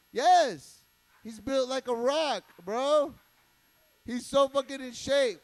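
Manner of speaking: loud brag. Real, not acted. Bragging loudly about dog.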